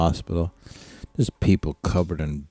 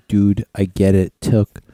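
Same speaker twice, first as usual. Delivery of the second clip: ordinary talk, nothing marked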